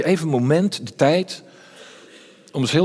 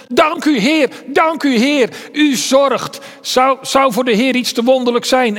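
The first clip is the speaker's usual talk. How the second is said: joyful thanks; 7 semitones higher, 3 dB louder than usual. Retold or imitated. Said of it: "En ze zeggen misschien al rennend".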